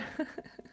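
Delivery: breathy little laugh; a quiet, embarrassed chuckle; real soft laugh. Real, not acted. Soft laugh after poem.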